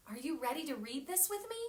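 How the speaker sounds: plain reading voice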